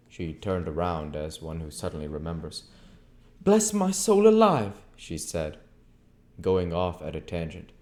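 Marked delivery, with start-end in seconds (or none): 3.4-4.8 loud, high, slow country exclamation; high, loud landlady outburst; landlady exclaiming. A narrator's reading. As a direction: cry it out like a startled landlady. Mrs Hall exclaims.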